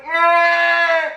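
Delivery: high, held goat-like bleat; loud mock wail like a goat's bleat; imitated goat-like crying. Reviewer shows how the actress cries, imitated goat-like wail. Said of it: "she made this weird noise. It sounded like a goat".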